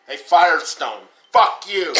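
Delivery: loud curse, rising in pitch; upset outburst. Real, not acted. Griping about an expensive car repair, upset outburst. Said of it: "You're really upset with Firestone".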